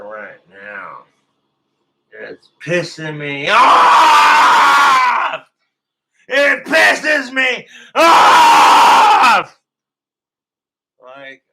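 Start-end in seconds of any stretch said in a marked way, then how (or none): 2.1-9.6 slow, drawn-out, very high and loud frustrated yell; furious shout. Real, not acted. Streamer screaming in frustration at failing the anthem. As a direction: roar the frustration out. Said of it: "I'm a fucking loser".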